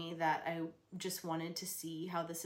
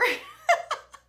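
squealing high laugh; 13 dB louder than usual. Real, not acted. Laughing at her own birth memory, a high squeal. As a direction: burst into a high, loud laugh.

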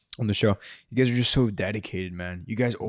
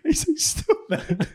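laughing; slower than usual. Real, not acted. Laughing while retelling scene.

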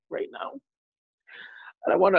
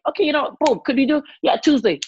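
mock eager booking; 8 semitones higher, 5 dB louder than usual. Acting out quick, easy scheduling.

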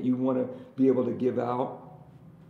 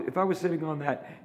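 ordinary talk

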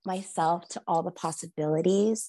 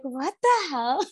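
friends mocking; 7 semitones higher than usual. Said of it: "And they're like".

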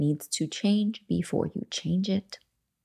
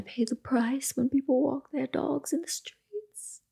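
whispered aside; 8 semitones higher, 5 dB quieter than usual. Real, not acted. Aside complaining about dog walkers.

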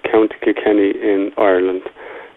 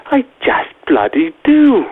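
much higher, slow, mock British accent with a laugh; slow mock British accent, pitched way up and laughing; Eloise Hawkins impression; 13 semitones higher, slower than usual. Caller quoting Eloise Hawkins in a laughing high mock British accent. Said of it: "in the words of, uh, Eloise Hawkins".

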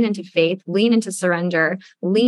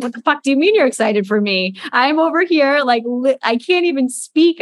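rushed, exasperated mock protest, pitch climbing; incredulous inner outburst; 6 semitones higher than usual. Student's inner reply to her teacher, incredulous. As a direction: let the unspoken disbelief burst out, half-playful. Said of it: "in my head, I was like".

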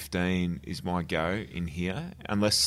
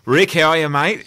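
loud, higher, beaming hello to the host; excited greeting; 8 semitones higher, 12 dB louder than usual. Real, not acted. Guest greeting the host on air, loud and excited. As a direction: burst in with a big mate's welcome.

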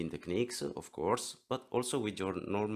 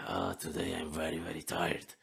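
tired character voice; 2 semitones lower than usual. Shown as a demonstration. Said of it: "you can split your personality".

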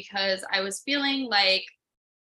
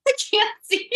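laughing; 12 semitones higher, 6 dB louder than usual. Retold or imitated. Laughing about poor sight.

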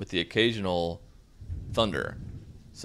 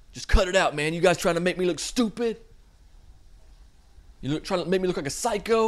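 scolding friends; 10 semitones higher than usual. Retold or imitated. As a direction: rein in your boys, half-joking. [name] scolds his crew.

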